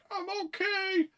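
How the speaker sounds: very high, tiny far-off voice; falling man's cry. Retold or imitated. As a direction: squeak it out from the bottom of the fall. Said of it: "and you just hear".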